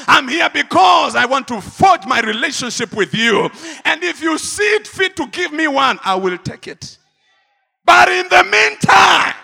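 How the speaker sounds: loud, high shouted sermon; fiery pulpit preaching, building to a shout; full-force preaching. Acted. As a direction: thunder it at the congregation.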